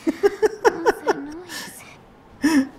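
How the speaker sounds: laughing through the words, high-pitched; cracking up while explaining. Real, not acted. Player explains the game noise to co-player, laughing.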